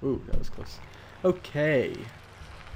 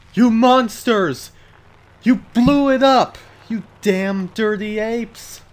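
loud, high, drawn-out mock outrage; urlo melodrammatico; 9 semitones higher, 11 dB louder, slower than usual. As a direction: cry out in hammy, over-the-top despair. Mock film outburst over the game.

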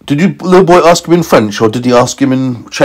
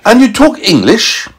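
surprised scientist; 12 semitones higher than usual. A narrator's reading. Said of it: "And he said".

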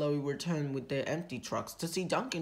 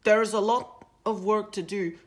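higher, louder bossy engine; bossy huff; 5 semitones higher, 6 dB louder than usual. A narrator's reading. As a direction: hurry the others along, huffing. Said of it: "huffed Duncan".

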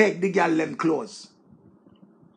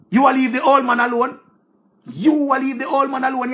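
louder, higher scolding telling-off; 4 semitones higher, 4 dB louder than usual. Acted. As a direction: tell her off sharply. Scolding the woman directly.

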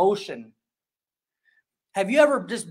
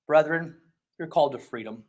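tempered down; 5 semitones lower than usual. Retold or imitated. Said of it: "he tempers it down again".

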